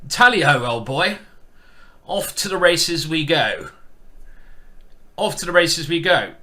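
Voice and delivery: loud mock tally-ho call; jokey toff sending off the charge; hammed-up old-boy gentleman. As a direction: cry out like a playful huntsman. Repeats mock gentleman's hunting cry.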